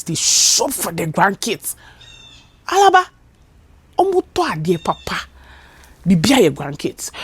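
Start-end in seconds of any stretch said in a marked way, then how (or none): 2.7-5.3 excited grandma. Retold or imitated. Grandmothers exclaiming.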